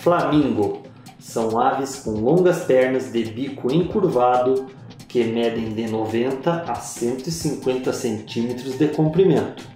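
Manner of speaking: ordinary talk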